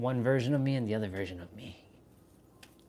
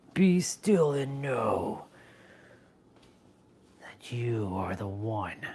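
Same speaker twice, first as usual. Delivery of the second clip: starts slow and level, drops to a whisper; slow guru phrase fading to whisper; 2 semitones lower, slower than usual. Pretend. Streamer intoning mock wisdom, ending in a whisper. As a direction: intone then breathe the rest. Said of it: "I talk like that a lot".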